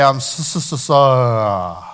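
mock stammer stuck on the s; Fonz stammering. Imitating the Fonz who can't say sorry. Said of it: "he could not say".

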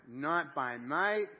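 very slow, drawn-out, loud recitation; slow and loud, each word held; leading group reading. Shown as a demonstration. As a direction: stretch it out so a congregation can read along. Leads congregation reading aloud together.

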